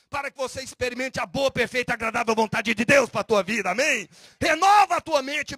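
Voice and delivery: loud, fiery pulpit proclamation building to a shout; a pastor thundering scripture at the congregation; full-force preaching. Acted. Preacher reading Paul at full force.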